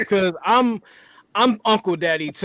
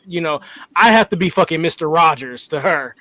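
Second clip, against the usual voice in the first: normal talking voice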